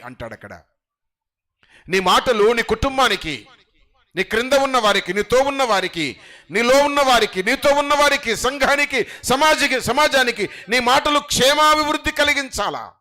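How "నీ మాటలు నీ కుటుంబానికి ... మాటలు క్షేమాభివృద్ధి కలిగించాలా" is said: rising sermon cry; full-force preaching. Acted. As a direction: proclaim it to the whole hall. Preaching scripture loudly.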